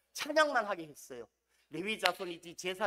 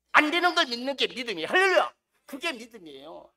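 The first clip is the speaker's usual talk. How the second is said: fervent preaching; 2 semitones higher, 7 dB louder than usual. Acted. Pastor urging the congregation, fervent loud preaching. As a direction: build it like a revival pastor.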